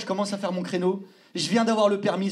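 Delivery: ordinary talk, nothing marked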